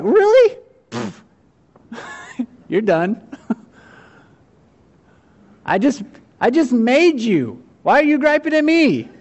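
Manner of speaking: high, loud, scoffing disbelief; incredulous scoff. Pretend. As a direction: laugh off the pie's nerve, mock offended. Maker scoffing at mud pie.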